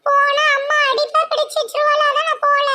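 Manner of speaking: put-on high, childish voice with a giggle. Little boy telling his aunt why he won't go home, high childish voice. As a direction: play a little boy whining to auntie.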